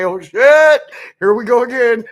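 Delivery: high, loud mock dismay; exasperated outburst. Voicing the look on her face, loud mock exasperation. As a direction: blurt it out, tipping into laughter. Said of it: "It's the look on her face. She's like".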